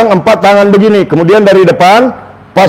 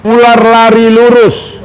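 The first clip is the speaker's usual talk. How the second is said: slow, sustained, higher-pitched words; slow model phrase; 4 semitones higher, slower than usual. Shown as a demonstration. Therapist leads a stroke patient's speech drill, slow and drawn out. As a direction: stretch each word out for the patient to copy. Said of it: "Nah, sekarang coba".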